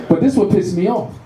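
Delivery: ordinary talk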